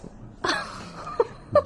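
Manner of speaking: a breathy, high little laugh of amusement; amused laugh. Acted. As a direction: laugh it off, tickled by the absurdity. Poirot laughs at the verdict.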